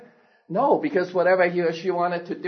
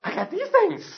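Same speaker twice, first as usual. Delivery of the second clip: put-on much higher, squeaky baby bird; 4 dB louder than usual. Preacher voicing the falling baby bird, high-pitched. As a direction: lift way up into a tiny bird's voice. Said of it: "He's going".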